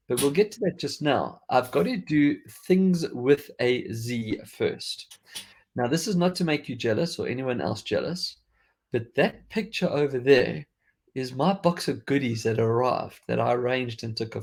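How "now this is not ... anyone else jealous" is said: usual speaking voice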